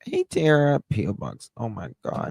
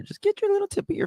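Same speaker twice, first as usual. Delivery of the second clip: squeaky demo; faster than usual. Shown as a demonstration. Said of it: "I tell you guys to hit the thumbs up".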